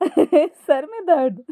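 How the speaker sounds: slow, high question wrapped in laughter; laughing hard. A narrator's reading. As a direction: crack up while asking. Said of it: "जोर-जोर से हंसती है". (ज़ोर-ज़ोर से हंसते हुए)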